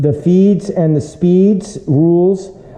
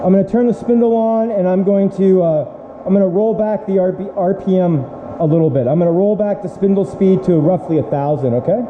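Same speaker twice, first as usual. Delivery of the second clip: raised, high-pitched voice over the noise; shouting over machinery; 6 semitones higher than usual. Real, not acted. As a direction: call out above the running mill. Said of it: "I'll be yelling over the machine".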